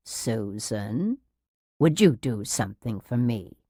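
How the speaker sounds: soft, low, excessively reasonable; hushed patronizing nurse; syrupy, patronizing. A narrator's reading. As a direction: coax her with sugary condescension. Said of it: "excessively reasonable, syrupy, patronizing tone of voice".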